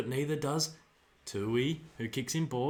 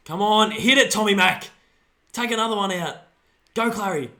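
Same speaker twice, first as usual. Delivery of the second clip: loud, higher shouts of encouragement; urging shouts; 7 semitones higher, 10 dB louder than usual. Real, not acted. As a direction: push the players on from the couch. Urging players on at TV.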